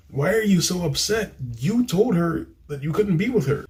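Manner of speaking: high-pitched, incredulous telling-off; exasperated scolding. Acted. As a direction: scold him in disbelief, pitched well up. Mock scolding of the film's hero.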